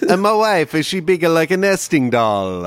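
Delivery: put-on high, loud hammy Ukrainian accent. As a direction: ham up a Ukrainian peasant. Joking Ukrainian voices.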